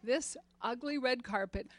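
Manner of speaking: ordinary talk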